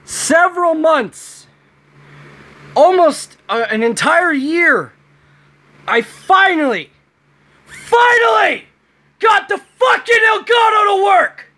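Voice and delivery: real high-pitched scream of joy; happy screaming. Real, not acted. Vlogger celebrating the Elgato finally working, ecstatic screaming. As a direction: scream your lungs out with happiness. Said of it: "I am screaming my lungs out, literally!".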